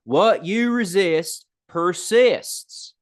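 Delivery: hammed-up Southern twang, stretched vowels; Southern accent. Shown as a demonstration. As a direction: have fun with a drawn-out Southern accent. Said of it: "Sounds more fun in a Southern accent".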